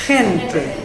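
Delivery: "Gente" with a throaty German-style H for the G; guttural G. Shown as a demonstration. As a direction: say "Gente" starting with a raspy kh sound. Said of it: "now the word becomes a H".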